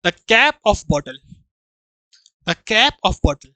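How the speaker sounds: each English word spelled out very slowly for students to copy; teacher reading the correct phrase slowly to the class, slow dictation. Shown as a demonstration. Teacher to students writing the correct form, slow dictation. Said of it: "फिर हम इसको ऐसे बोलेंगे".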